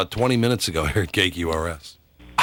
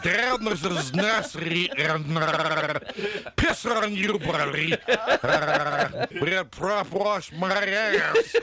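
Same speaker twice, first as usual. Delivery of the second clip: underwater voice; 9 semitones higher, much slower than usual. Pretend. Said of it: "use the underwater voice".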